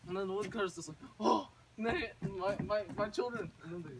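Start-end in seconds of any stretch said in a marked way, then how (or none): 1.2-3.5 higher, overcome father's exclamation; acted happy outburst, pitched up. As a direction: stammer with joy at seeing them. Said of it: "they're just very happy".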